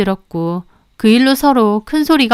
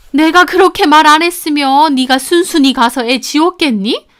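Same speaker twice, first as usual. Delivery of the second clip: contemptuous mother; 3 semitones higher than usual. Retold or imitated. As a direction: put her in her place. Mother admits deceit.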